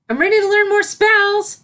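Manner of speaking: put-on high, bright young filly, keen to start; a small filly bursting with energy; eager filly voice. A narrator's reading. Filly announcing to Celestia at breakfast, eager. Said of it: "declared Sunset".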